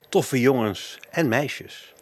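slow, drawn-out announcer read of the title; title announcement. Acted. Radio host slowly announcing the episode title.

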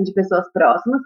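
ordinary talk, nothing marked

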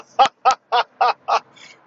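loud high burst of laughter; big laugh. Real, not acted. Podcaster laughing at his own greeting to listeners, loud and high. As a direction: crack up at your own bit.

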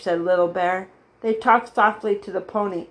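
ordinary delivery, nothing marked

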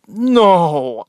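loud, drawn-out mock no; sarcastic answer. Nurse answering whether he's from here, sarcastic. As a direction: stretch out a sarcastic no.

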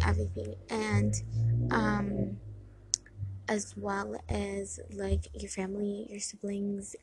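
usual speaking voice

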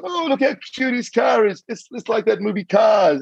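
high, cooing mock voice of the women; squealing women teasing about the tiny car. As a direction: coo over the cute little car. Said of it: "all the women are going".

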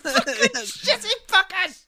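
very high, slow squealed curse; squeaky outraged curse at cyclists on the pavement; squeaky mock rage. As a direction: squeal it in helpless fury. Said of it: "I'm like".

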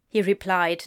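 usual speaking voice

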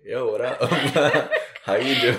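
loud, laughing "yo, what up"; excited greeting. Retold or imitated. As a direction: hype greeting to an old fling. Greeting old hookup on app.

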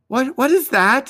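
high, eager question; wounded boy hearing a faint cry. Acted. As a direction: ask eagerly, waking up. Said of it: "he asked eagerly".

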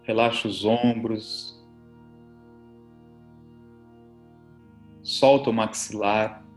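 soft, low relaxation voice; soothing spa whisper. Acted. Leads guided relaxation over music.

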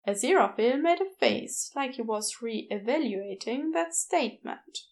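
ordinary delivery, nothing marked

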